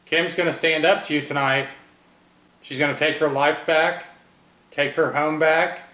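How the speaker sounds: loud defiant declaration. Real, not acted. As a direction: speak up boldly to the spirit. Defiant calling out to spirit.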